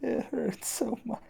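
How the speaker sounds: tiny, high, very soft moan; feeble pained voice. Acted. Player gasping after rescue from space, feeble pained voice. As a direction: squeak it out weakly.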